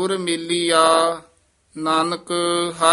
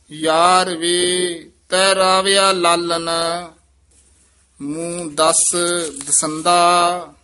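slow measured chant of scripture; scripture recitation; 6 dB louder, slower than usual. Acted. Teacher recites Gurbani for students, in a measured chant. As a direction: recite each line with devotion.

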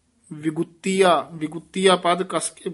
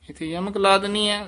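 normal talking voice

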